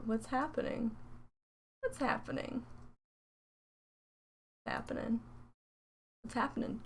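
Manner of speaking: very soft, half-whispered muttering; hushed bewilderment. Acted. Streamer puzzles over an email from PayPal, hushed bewilderment. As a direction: murmur it under your breath.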